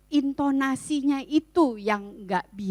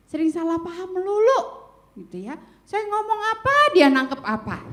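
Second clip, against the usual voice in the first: higher-pitched, acted grumble about a partner; exasperated complaint; 7 semitones higher, 6 dB louder than usual. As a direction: complain like a fed-up spouse. Voicing a spouse's exasperated complaint.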